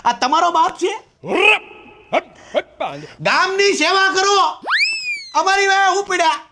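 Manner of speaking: high-pitched comic outburst; indignant. Acted. Worked-up guest complains about father-in-law. (ઊંચા અવાજે ચિડાઈને)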